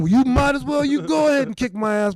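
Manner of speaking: high, loud challenge; shouted, cocky dare, pitched high. Retold or imitated. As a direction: yell the dare at the big guy. Challenging the huge guy.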